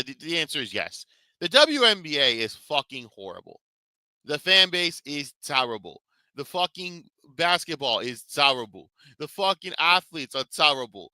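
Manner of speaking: ordinary talk, nothing marked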